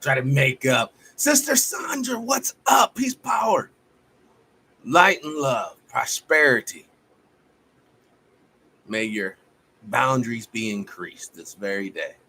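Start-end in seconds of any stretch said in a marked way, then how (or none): 1.1-6.9 high, loud greeting yelled to Sister Sandra; excited on-air shout-out to a viewer. Real, not acted. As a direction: hail her joyfully across the room.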